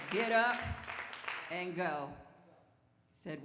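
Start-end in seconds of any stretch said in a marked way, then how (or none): 0.1-2.1 loud, raised divine order; booming retold command. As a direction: proclaim it, pausing before "go". Voices God's command.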